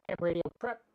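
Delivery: normal talking voice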